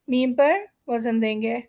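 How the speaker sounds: ordinary talk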